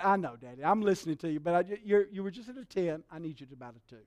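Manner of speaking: low, soft, patient reply; retold soothing child. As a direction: gently talk Daddy down, fading quiet. Child gently answers a stern father.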